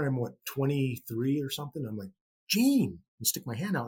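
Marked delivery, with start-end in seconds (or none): 2.5-3.0 put-on higher, eager kid calling Gene; like a young kid. Starstruck fan calls to his idol, higher eager kid. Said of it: "like a young kid".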